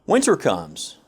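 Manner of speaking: plain reading voice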